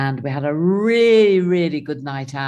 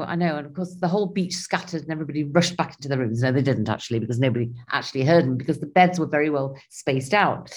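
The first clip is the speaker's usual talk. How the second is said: ordinary talk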